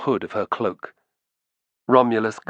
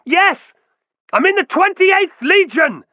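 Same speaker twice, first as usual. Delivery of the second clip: much higher, louder, slow shout; 6 dB louder, slower than usual. A narrator's reading. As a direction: shout each word over the din. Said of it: "he shouted".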